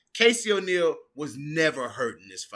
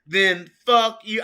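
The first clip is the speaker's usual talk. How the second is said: quoting Casey loudly; 8 semitones higher, 7 dB louder than usual. Retold or imitated. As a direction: deliver the bleeped line in full. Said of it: "I'll say what they bleeped out".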